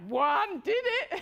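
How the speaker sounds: slow, laughing the words out; laughing at the idea of only once. Real, not acted. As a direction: giggle through it. Laughs through the line.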